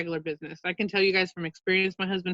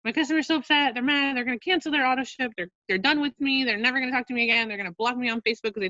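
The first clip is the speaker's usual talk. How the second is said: spiral into a customer-service panic; panicked worrying; 5 semitones higher than usual. Retold or imitated. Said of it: "they're like".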